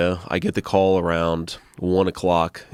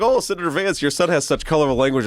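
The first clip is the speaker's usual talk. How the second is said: higher, prim mock-polite voice; judgy passengers; 10 semitones higher than usual. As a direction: mimic the tutting strangers, faux sweet. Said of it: "And it's like".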